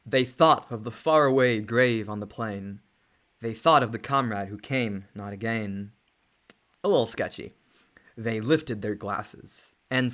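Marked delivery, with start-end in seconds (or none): 0.0-5.9 poem recitation. Acted. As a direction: recite it like a ballad. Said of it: "[name], you give it a shot".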